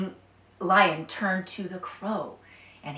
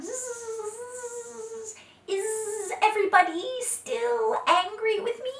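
high, thin buzzing voice; whining mosquito; 14 semitones higher, slower than usual. Acted. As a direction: buzz in an ear. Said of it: "whining in people's ears".

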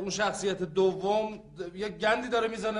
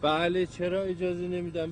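normal talking voice

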